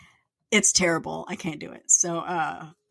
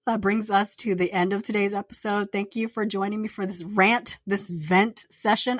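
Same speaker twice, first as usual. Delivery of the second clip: ordinary talk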